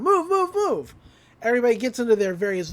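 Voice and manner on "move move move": barked orders. Retold or imitated. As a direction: hurry everyone out. Agent orders the manhunt.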